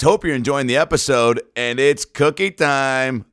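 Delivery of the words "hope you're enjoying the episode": usual speaking voice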